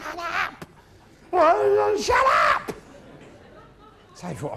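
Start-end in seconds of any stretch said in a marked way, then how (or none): 0.0-2.7 put-on fed-up bellow at a drunk mate; loud exasperated yelling; angry shout. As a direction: yell it out in a sudden burst.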